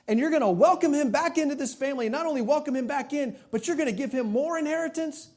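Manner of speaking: high, loud, indignant whine; indignant older brother. Retold or imitated. Older son protesting to his father, high indignant complaint. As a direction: play the wronged older son, voice up high and pushing.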